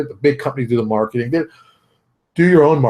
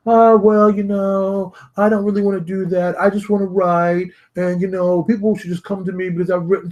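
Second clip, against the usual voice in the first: mock whiny excuse; 6 semitones higher than usual. Mocking his friend's excuse.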